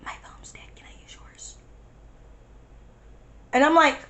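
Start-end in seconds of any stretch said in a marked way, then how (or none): 0.1-1.6 barely audible whispered request; retold whisper. Friend asking to borrow her phone, retold whisper. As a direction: whisper as if hiding. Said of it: "it was like S M R the whole time".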